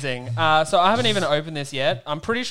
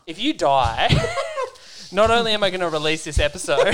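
laughing; 5 semitones higher, 5 dB louder than usual. Real, not acted. Joking about death, laughing.

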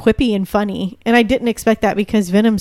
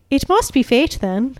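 higher-pitched, put-on Irish accent; 4 semitones higher than usual. Shown as a demonstration. Actress trying a line from her show, Irish accent. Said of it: "Let's hear your Irish".

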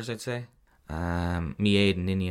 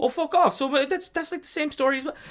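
shocked disbelief. Real, not acted. Disbelief at Holmes escaping.

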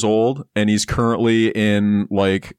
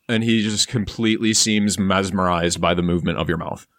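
usual speaking voice

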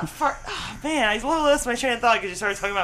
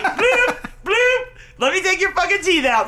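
high popping bloops then a gleeful threat; mock pulling sound; 9 semitones higher than usual. Wife imagines pulling teeth, making a popping sound. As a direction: pop the teeth out with a silly bloop. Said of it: "I'd be like".

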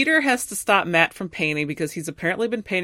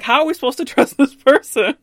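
higher and louder, half-laughing alarm; alarmed Peter; 8 semitones higher, 8 dB louder than usual. Retold or imitated. Peter doubting the hacker, loud incredulous alarm. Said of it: "And Peter's like".

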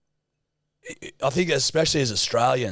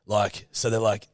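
usual speaking voice

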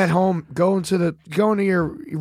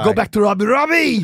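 Arnold Schwarzenegger; 2 semitones higher, 3 dB louder than usual. Acted. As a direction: call out like an action hero. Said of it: "Arnold, I can't".

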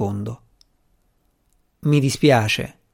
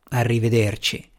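a tired, deflated goodbye, a bit softer; fiacco; 4 semitones lower, 3 dB quieter than usual. A narrator's reading. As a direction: let the word sag, spent. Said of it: "disse fiaccamente Jens". (un arrivederci fiacco e stanco)

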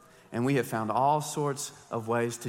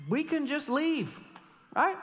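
mock breezy; 12 semitones higher, slower than usual. Mocking easy way out.

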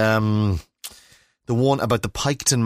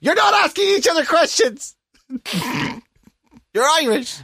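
pretend shout. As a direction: bellow it at the kids like you've had enough. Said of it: "I feel like shouting out the window".

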